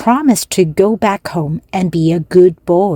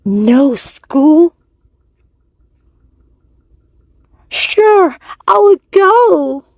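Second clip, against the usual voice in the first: much higher and louder, delighted; thrilled puppet boy; 10 semitones higher, 5 dB louder, slower than usual. Acted. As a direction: squeal it out like a boy who just heard no school. Pinocchio thrilled by no school.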